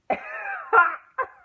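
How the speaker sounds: very high, loud yelps; hammed-up bursts of breathy exclamation; imitated shocked outburst. Nurse reacting during the cervix check, imitated loud high yelp.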